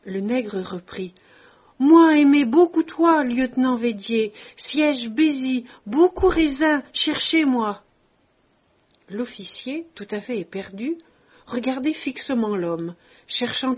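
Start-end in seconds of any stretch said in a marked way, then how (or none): 1.8-7.8 loud, higher, eager broken French; excited giant. A narrator's reading. Tombouctou greeting his old lieutenant, loud and overjoyed. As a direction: gush it out to an old officer, loud and high.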